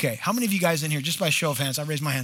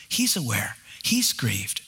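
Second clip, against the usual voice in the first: hushed; 8 semitones lower, slower than usual. Real, not acted.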